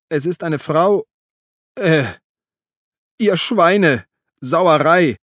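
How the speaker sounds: high, loud outraged shouting; disgusted cursing. A narrator's reading. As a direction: curse up at them from the ditch. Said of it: "Platschen und Fluchen". (laut und empört fluchend)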